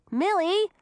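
high call down a tunnel. A narrator's reading. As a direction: call her name from afar. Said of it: "Audun called".

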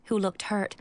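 usual speaking voice

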